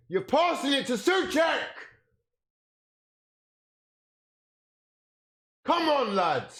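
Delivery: disgusted shout. Real, not acted. Fan yelling at his player passing to the opponent, disgusted shout. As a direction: yell it at the screen in contempt.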